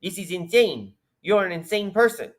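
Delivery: a hint of a puppet scolding its owner. Host voicing the puppet telling Yi he is insane. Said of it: "Yi puppets, uh, Netan's voice going".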